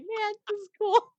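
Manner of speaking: lightly voiced friendly high; a hint of a cheerful clerk; nice worker voice. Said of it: "I was being nice about it".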